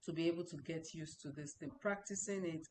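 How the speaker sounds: normal talking voice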